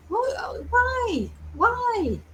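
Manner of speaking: high, disbelieving cry of why; incredulous what why. Real, not acted. Baffled by drug combination, high incredulous why. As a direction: pitch leaps up in baffled disbelief.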